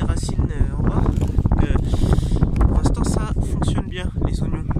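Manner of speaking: strong gusts roaring over the mic; talking outdoors into heavy wind. Real, not acted. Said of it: "je sais pas si vous entendez le vent".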